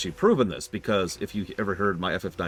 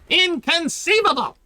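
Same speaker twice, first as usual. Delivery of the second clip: much higher, louder squeaky Ferengi cry; 8 dB louder, slower than usual. Pretend. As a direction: shriek it like an outraged Ferengi. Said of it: "identical to my Ferengi voice".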